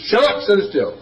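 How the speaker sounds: high snapped shut up; retold angry shout; mock yell. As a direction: cut him off sharply. Norm yelling in retold story.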